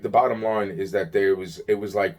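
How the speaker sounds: usual speaking voice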